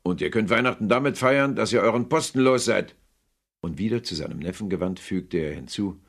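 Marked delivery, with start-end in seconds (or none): 0.0-3.0 growled threat. Acted. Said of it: "knurrte Scrooge".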